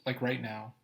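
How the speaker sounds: ordinary delivery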